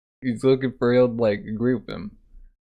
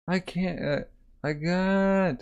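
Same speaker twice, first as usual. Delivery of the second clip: mock distress; 8 semitones higher, much slower than usual. Voicing someone upset by crying child.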